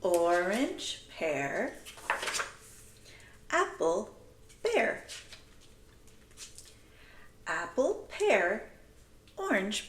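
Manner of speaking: put-on sing-song read-aloud for children; lively picture-book reading to a class. Shown as a demonstration. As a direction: read it out with a bouncy storybook lilt. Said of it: "So it goes like this".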